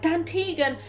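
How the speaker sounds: a lightly voiced queen defending her father; mock Anora.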